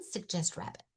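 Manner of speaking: plain reading voice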